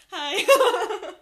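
high, loud, laughing fake-cheery hi; a bridesmaid greeting the groom with a guilty laugh; awkward greeting. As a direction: force a cheerful hello through guilt.

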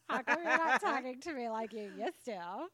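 put-on a touch of Valley Girl whine; loud, teasing Valley Girl questions. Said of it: "our Valley Girl voices".

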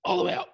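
high, loud exhale; a loud collapsing sigh; big release sigh. Shown as a demonstration. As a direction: let all the air fall out. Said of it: "open our mouth and drop everything out".

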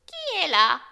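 put-on high, loud old granny's call; grandmother calling out. A narrator's reading. Grandmother calls to the door.

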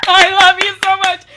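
loud, high squeal of joy; delighted shout. Real, not acted. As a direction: shriek it out in delight. Shrieking delight at French waiter.

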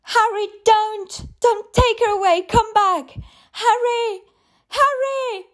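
very high, pleading shrieking cries; mother screaming at the ghost taking her child; demented screams. Acted. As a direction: scream after him, begging him not to take her.

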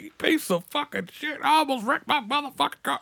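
high-pitched mock cussing; comic high grumbling tirade; irate old driver. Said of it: "they come back".

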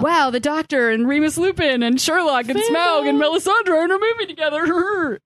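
high-pitched giddy nerd gush; mock fangirl squeal of glee. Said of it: "So I'm just going".

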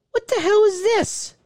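high-pitched startled outburst; startled disbelief. Real, not acted. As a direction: squeal it in baffled disbelief. Startled by something on stream.

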